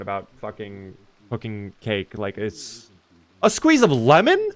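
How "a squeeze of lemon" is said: high, louder disbelieving question; incredulous. Real, not acted. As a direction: question it in high-pitched shock. Shocked by lemon in cake recipe.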